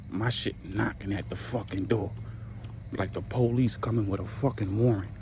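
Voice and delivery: ordinary delivery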